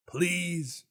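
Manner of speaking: high, loud mock plea; playful begging. Begging the game for a budget.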